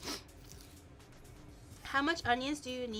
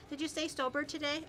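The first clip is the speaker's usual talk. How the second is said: ordinary talk, nothing marked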